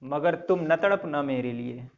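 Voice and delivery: a low, soft recital of a love couplet; soft, unhurried shayari reading; poem recitation. Acted. Recites a couplet before the lesson.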